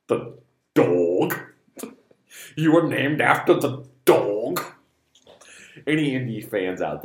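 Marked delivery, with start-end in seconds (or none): 0.1-4.6 slow, drawn-out mock disbelief; hammed-up incredulous film voice; incredulous movie quote. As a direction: act out amazed questioning, stretching the words. Acts out movie reply, incredulous.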